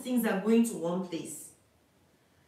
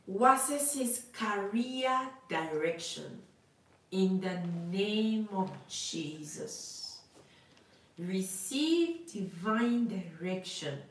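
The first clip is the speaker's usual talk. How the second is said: slow prophetic declaration; slower than usual. Acted.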